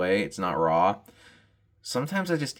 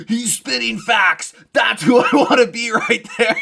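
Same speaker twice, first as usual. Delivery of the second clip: starstruck fanboy; 12 semitones higher, 8 dB louder than usual. Retold or imitated. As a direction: gush like a starstruck young fan. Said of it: "every young dude in America sees him and goes".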